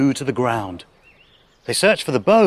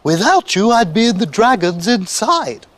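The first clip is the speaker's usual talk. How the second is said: high, louder gush of relief; grateful witch; 7 semitones higher, 3 dB louder than usual. A narrator's reading. Witch thanks friends who saved her, crying gratefully. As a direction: exclaim with heartfelt gratitude, pitched up. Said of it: "the grateful witch cried".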